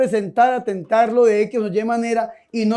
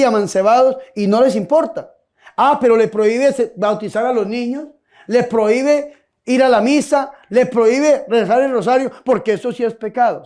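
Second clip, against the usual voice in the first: normal talking voice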